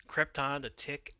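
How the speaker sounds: usual speaking voice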